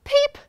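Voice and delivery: high, loud little peep; short piercing chick peep. A narrator's reading. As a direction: squeak one sudden peep. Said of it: "wolf replied sadly".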